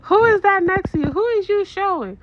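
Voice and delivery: high, loud mock daughter prying over FaceTime; nosy daughter. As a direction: squeal it like a curious teen. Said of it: "and she goes".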